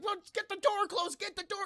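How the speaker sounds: high-pitched frantic mock urging; panicked orders. The crew start panicking at the gate, high mock-panicked orders.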